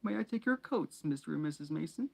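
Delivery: high, sugary put-on voice; simpering Dudley. A narrator's reading. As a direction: simper it like a spoiled boy. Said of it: "simpering smile".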